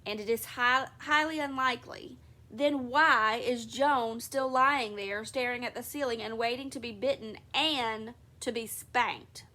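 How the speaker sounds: father tells his daughter to stop and listen, loudly. Acted. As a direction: raise your voice to settle the child. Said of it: "he added in a loud voice".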